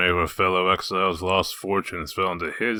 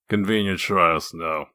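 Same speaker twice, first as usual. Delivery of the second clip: ordinary talk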